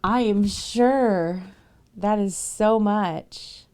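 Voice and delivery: emphatic. Real, not acted. Emphatic about gift.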